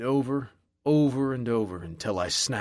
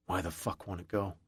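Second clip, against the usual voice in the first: usual speaking voice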